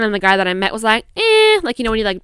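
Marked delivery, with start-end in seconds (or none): 1.2-1.6 high, loud, drawn-out "eh"; a skeptical so-so noise, pitched way up; doubtful "eh". Retold or imitated. Guy she met doubts the good-person claim, skeptical. Imitates guy's so-so verdict.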